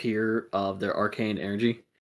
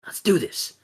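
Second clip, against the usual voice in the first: higher, encouraging voice; rally a young teammate; upbeat team-up line; rallying hero; 6 semitones higher than usual. Acted. Hero rallies the child.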